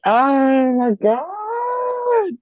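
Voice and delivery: high, drawn-out gasp of disbelief; real shock at winning; stunned excitement. Real, not acted. Winner learns she won the finale, stunned and thrilled.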